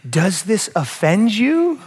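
slow question, YOU drawn out and rising high; pointed question. Acted. Jesus to grumbling disciples, slow pointed question climbing on YOU. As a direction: stretch the words and lift YOU high at the end. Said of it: "Jesus said to them".

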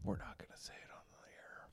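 breathy hush, voiceless; whisper. Real, not acted. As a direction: whisper it off mic. Hushed off-air aside.

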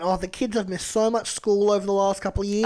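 ordinary talk

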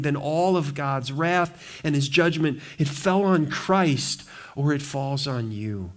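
normal talking voice

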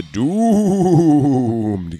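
long, loud drawn-out wail; ominous mock proclamation, hugely drawn out; doomed guy's cry. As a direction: stretch it out theatrically, high then falling. Mimics the doomed guy theatrically.

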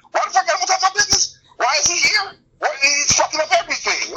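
squeaky complaining boss; high, whiny outrage; high indignant mock voice. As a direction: pitch up into a mock tantrum. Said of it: "going like".

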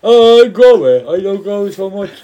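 ordinary talk

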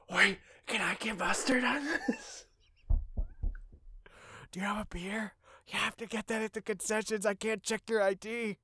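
high hushed theater chatter; whisper shout. Pretend. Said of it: "that whisper shout".

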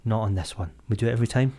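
soft pleading to himself; muttered self-plea. Retold or imitated. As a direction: beg yourself under your breath. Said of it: "and I was like".

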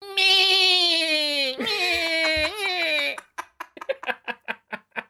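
long high shrieking dinosaur cry. Pretend. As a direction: screech like a silly dinosaur. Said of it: "Owen Wilson as a dinosaur".

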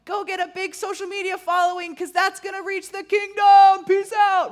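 high, loud, hammed-up mock hype with a shouted sign-off. Pastor mocking the social media advice, loud high hype voice. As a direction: lampoon the social-media guru pitch.

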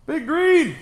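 very high loud shout; mock shout. Friend mimics a character yelling for the Hulk, loud shout. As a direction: yell it like calling the Hulk.

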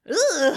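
a high, loud "ugh" of revulsion; loud disgusted groan. Retold or imitated. Viewer reacting to the father's creepy moment, loud disgusted groan. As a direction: recoil in disgust. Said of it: "and I went".

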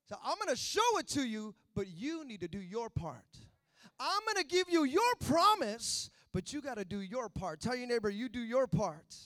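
loud, high-pitched revival preaching; preaching at full force. Acted. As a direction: drive the point home to the congregation.

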